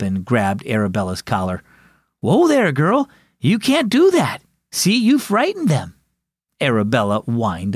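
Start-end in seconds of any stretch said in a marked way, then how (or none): 2.2-5.9 high, urgent telling-off; alarmed child's reprimand; scolding the dog. Acted. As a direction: rein in an excited dog, pitched high.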